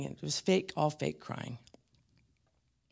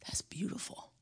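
sudden hushed whisper; 4 semitones higher, 11 dB quieter than usual. Real, not acted. Preacher to congregation, whispered. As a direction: drop it to a whisper.

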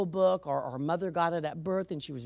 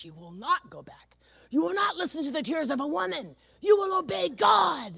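building from firm to a high loud shout; wife ordering husband to obey God; rising commanding shout; 11 semitones higher, 4 dB louder than usual. Retold or imitated. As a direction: command him, louder each line. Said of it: "I spun around and I said".

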